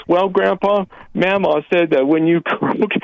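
a hint of a little kid telling Grandpa; lightly voiced grandchild. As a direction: pipe up with the punchline. Caller voices grandchild giving the punchline.

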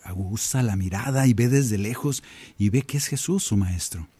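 ordinary talk

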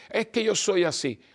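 lightly voiced objector pleading an excuse; mock excuse-maker. Preacher imitating a defensive listener, mock protest.

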